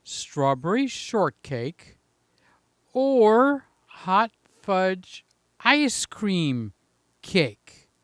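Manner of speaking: drawn-out, savouring each dessert; slow mulling. Acted. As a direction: weigh it up slowly and dreamily. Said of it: "Let me just mull that over".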